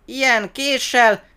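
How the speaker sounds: high, loud, drawn-out crowing; triumphant boast. A narrator's reading. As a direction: show off slowly at the top of your voice. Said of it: "A kismajom diadalmasan fintorgott".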